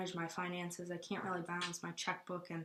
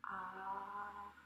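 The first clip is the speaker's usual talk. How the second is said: tongue held down saying aah; 6 dB quieter than usual. Shown as a demonstration. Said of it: "Could you say, "Ah".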